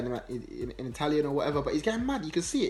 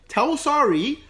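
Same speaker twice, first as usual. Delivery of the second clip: loud, high, drawn-out protest; mock outraged player digging in, voice raised; acted defiant refusal; 6 semitones higher, 7 dB louder than usual. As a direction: refuse emphatically, stretching the words. Acting player refusing to be substituted.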